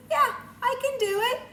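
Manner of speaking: put-on high, loud, eager child's voice; proud little girl. Teacher voicing a girl in the book, high and loud. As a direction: cheer it like a proud kid.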